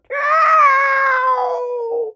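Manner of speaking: very high, drawn-out meow; cat meow. A narrator's reading. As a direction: stretch a shrill cat cry. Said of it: "And the cat meowed".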